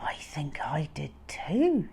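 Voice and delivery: trembling hushed whisper; shaky and scared, barely voiced; frightened thief whispering back; quivery voice. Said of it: "said Max in a quivery voice".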